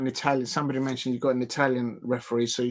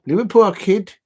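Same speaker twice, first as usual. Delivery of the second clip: "Liverpool kid" again, louder Scouse attempt; botched but bolder Scouse "Liverpool kid", louder; louder Scouse retry; 6 semitones higher, 8 dB louder than usual. Shown as a demonstration. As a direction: push the Scouser accent harder on "Liverpool kid". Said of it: "I'm not saying it right".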